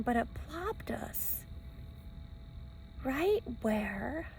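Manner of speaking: slow hushed whisper. Real, not acted. Telling viewers the lucky spot, whispered. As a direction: let it slip out breathily.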